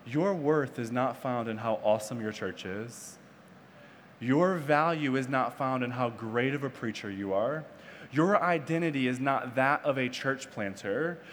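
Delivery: low, soft, deliberate pronouncement; solemn voice of God. Retold or imitated. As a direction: speak it quietly as God correcting someone. Voices the Lord speaking clearly.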